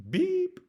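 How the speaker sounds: high-pitched imitated phone beep; mimicked high beep. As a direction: make a short electronic beep.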